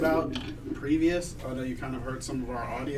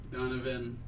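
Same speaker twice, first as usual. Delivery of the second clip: ordinary talk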